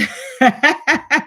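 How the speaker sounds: short high burst of laughter. Real, not acted. Streamer reacting to a viewer's comment, laughing.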